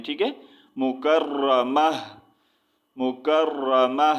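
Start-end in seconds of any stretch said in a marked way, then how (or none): 0.8-1.9 مکرمہ very slow, ending in a breathed h; drawn-out مکرمہ closing on "-mah"; pausal ha ending. Shown as a demonstration. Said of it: "ہا میں change کر دینا ہے". 3.0-4.1 مکرمہ very slow, ending in a breathed h; drawn-out مکرمہ closing on "-mah"; pausal ha ending. Shown as a demonstration. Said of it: "ہا میں change کر دینا ہے".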